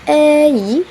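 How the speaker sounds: "ei" held long, high and loud; demonstrated diphthong. Shown as a demonstration. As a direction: stretch "ei" from eh into ee. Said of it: "the next diphthong is".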